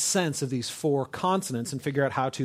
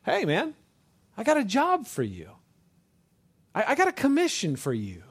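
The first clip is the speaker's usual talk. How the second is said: casual, high-pitched recruiting pitch; God; 8 semitones higher than usual. Retold or imitated. Preacher voicing God calling Moses, casual and high. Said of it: "It's God basically saying".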